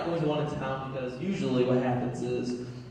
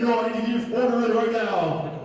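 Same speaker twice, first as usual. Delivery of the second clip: higher, pushy mock demand; insistent order taker; 5 semitones higher, 4 dB louder than usual. Drive-thru worker demanding the order, insistent. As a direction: press the customer impatiently.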